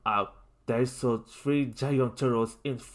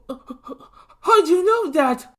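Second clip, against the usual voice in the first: high, loud, slow shout; confused shout; 9 dB louder, slower than usual. A narrator's reading. Lincoln shouts, confused by the news. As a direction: yell out in confusion. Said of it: "Lincoln shouted again".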